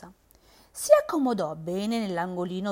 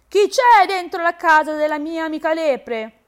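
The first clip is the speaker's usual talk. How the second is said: frog calls out higher and louder; 7 semitones higher, 7 dB louder than usual. A narrator's reading. The frog at the den entrance, calling out loudly. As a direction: hail the burrow boldly. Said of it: "chiamò forte".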